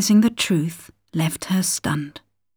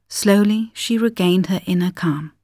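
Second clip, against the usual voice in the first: usual speaking voice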